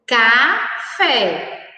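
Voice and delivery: "Café" split into two syllables, Ca, fé. Shown as a demonstration. Teacher showing the syllable in an example word, syllable by syllable. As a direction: say "Café" in separate syllables, pausing between them. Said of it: "A sílaba K é escrita".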